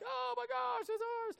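high, thrilled squeal of disbelief; delighted high-pitched gasp; excited squeal. Retold or imitated. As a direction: squeal like you just won. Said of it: "And I'm like".

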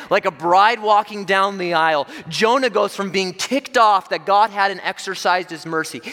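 high, loud, fervent preaching; preaching at full force. Acted. Preacher paints Jonah's elation to the congregation, loud and fervent. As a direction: build to a fiery peak.

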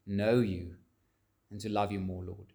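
soft, low-voiced pastoral prayer. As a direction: pray quietly and humbly before God. Opening prayer before the sermon.

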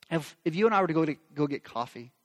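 ordinary talk

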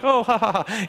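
polite fake little laugh; a forced courtesy chuckle; mock polite laugh, not really amused. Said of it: "that little courtesy laugh".

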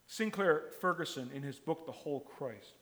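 usual speaking voice

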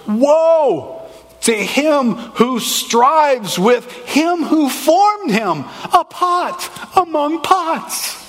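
hammed-up prophetic cry; prophet's voice. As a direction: intone it like a prophet with a laugh at the end. Voicing Isaiah's God.

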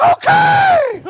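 very high, loud yelp, hammed up; mock yelp. Boy yelping at his dad's whooping, hammed-up shriek. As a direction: shriek it like a kid getting whooped. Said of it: "And I'm like".

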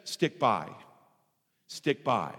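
calm divine voice, low and unhurried; God's calm reply. Retold or imitated. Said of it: "And he says".

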